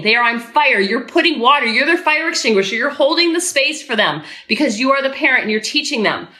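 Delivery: ordinary talk, nothing marked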